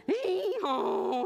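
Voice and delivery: high, drawn-out hee-haw; donkey bray. A narrator's reading. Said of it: "The bird chirped".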